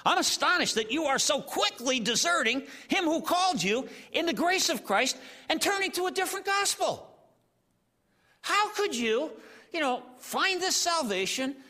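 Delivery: astonished, scolding Paul; indignant reading. Retold or imitated. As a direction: read it like Paul is ticked off. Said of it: "I'm really ticked".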